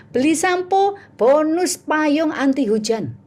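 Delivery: high, drawn-out promo call; sales pitch. Retold or imitated. Seller offering a joke bonus with shampoo, high sales pitch. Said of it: "Saya ngomongnya gini".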